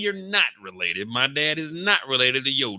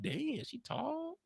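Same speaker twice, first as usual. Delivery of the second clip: impressed ogling; 12 semitones higher, 5 dB quieter, slower than usual. Retold or imitated. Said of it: "he's sizing her up like".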